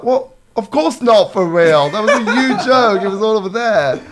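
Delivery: loud, high-pitched disbelief with a laugh; amused, incredulous outburst; exasperated. Acted. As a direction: protest as if it's blindingly obvious. Acts out exasperation at literal-minded listener.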